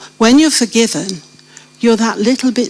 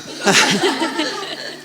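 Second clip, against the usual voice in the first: real laugh; 6 semitones higher, 6 dB quieter than usual. Real, not acted. Laughing at her own story.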